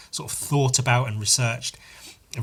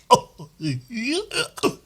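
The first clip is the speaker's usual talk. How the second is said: high laugh; 11 semitones higher than usual. Real, not acted. Laugh during mockery.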